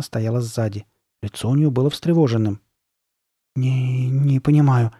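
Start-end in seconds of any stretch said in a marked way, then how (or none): none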